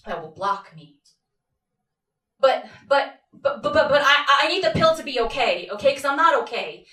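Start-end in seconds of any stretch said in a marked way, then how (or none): 2.4-6.9 raised, louder panicky pleading; desperate stammer. Acted. Patient needing the pill to work, desperate. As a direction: stumble on the start, then plead urgently.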